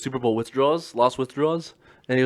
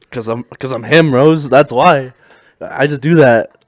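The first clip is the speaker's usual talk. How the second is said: loud, cocky retold brag; mock boasting; 11 dB louder, slower than usual. Brother bragging to little sister about the TV, mock swagger. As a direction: declare it loudly, drawing out the end.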